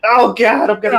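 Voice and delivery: high squeal of delight; squealing over cute baby. Retold or imitated. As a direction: gush like the baby's too cute. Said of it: "and I'm like".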